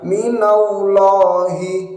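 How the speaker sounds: minallahi with the lam of Allah not thickened; a thin, light llah in minallahi; wrong minallahi. Shown as a demonstration.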